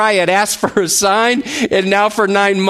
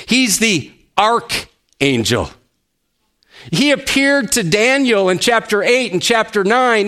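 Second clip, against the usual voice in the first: ordinary talk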